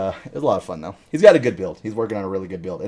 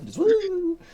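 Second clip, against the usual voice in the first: mocking whoop. Imitating friend running off.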